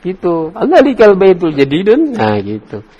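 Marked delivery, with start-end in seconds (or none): 0.5-2.1 high, showy question tune on "Allalikal baitul jadidun"; lively, higher-pitched demonstration of a question; stylish questioning intonation. Shown as a demonstration. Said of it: "ya kayak bergaya gitu".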